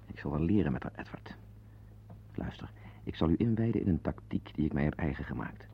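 soft, unvoiced confiding whisper; whispered aside. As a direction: lean in and whisper to the listener. Confiding aside, wife within earshot.

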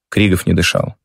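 ordinary delivery

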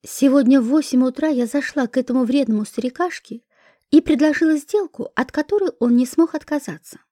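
ordinary delivery, nothing marked

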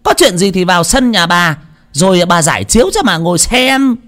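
high, mocking old woman voice; jeering crone calling out; shrill old hag taunting. Old woman jeering at the gawking women, high mocking voice.

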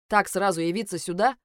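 indignant, rising challenge; angry incredulous question. A narrator's reading. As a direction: challenge her, voice lifting at the end. Леджер зло отчитывает героиню. (возмущённый вопрос с напором)

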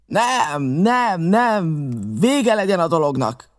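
the captain shouts to end the quarrel; captain's outcry. A narrator's reading. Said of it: "kiáltott föl egyszer".

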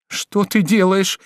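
high, drawn-out cry of protest; alarmed cry. A narrator's reading. Woman stops lover knocking on door, alarmed cry. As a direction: cry out in alarm, stretching the words. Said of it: "закричала Жервеза".

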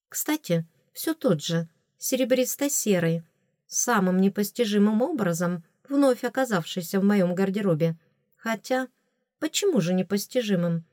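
ordinary delivery, nothing marked

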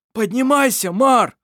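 high, loud plea; pleading. Acted. Irovar begging Mar to rise, loud high plea. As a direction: beg him to get up. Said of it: "попросил Ировар".

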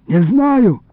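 high, pleading denial; frightened plea. A narrator's reading. Boy questioned by his captor, frightened plea. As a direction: whine it high in fear. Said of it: "взмолился я дрожащим голосом".

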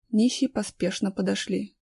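ordinary delivery

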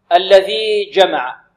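the jim of "جمع" fully closed, no slide; slow recitation. Shown as a demonstration. Teacher reciting slowly for students. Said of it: "الجيم شديدة".

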